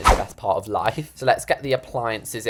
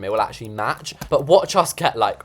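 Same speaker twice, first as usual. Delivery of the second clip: normal talking voice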